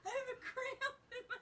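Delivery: helpless laughter with a cramp; laughing so hard it hurts; laughing through pain. Real, not acted. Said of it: "I'm laughing so hard".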